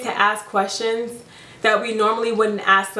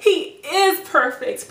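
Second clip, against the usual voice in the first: IS stressed high in a slow, dreamy "He is perfect"; smitten certainty; 7 semitones higher, much slower than usual. Acted. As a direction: gush it slowly, leaning hard on IS. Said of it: "But don't be like".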